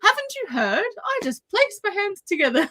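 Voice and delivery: high, light and teasing, with laughter creeping in; amused retold smugness. Retelling the hero's cheeky comeback to Guilt, amused and smug. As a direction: deliver the cheeky reply on the edge of laughing.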